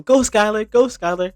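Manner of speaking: high, loud mock cheer; corny cheering. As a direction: cheer like a cringey fan. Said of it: "Kai being corny".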